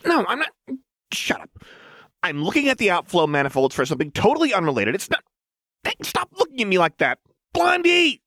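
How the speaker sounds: put-on high, fast manic girl bickering; Jinx voice. A narrator's reading. Jinx arguing with Fishbones, calling Lux, high frantic voice. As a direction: rattle it off high and fast, ending in a yell.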